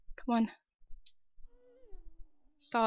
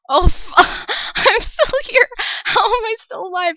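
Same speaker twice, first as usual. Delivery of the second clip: high, loud astonished shout; giddy relief yell; thrilled disbelief; 8 semitones higher, 11 dB louder than usual. Real, not acted. Surviving near death.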